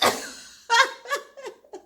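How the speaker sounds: high loud laugh of disbelief; incredulous laughter. Real, not acted. Retelling the meth confession, high incredulous laugh. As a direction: laugh helplessly at it.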